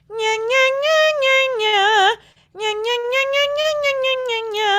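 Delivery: high nasal nya scale; nasal twang. Shown as a demonstration. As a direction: sing it through the nose. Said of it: "I would practice this in this way".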